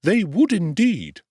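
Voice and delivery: high, slow, fawning undertaker voice; high ingratiating tradesman; oily undertaker. Acted.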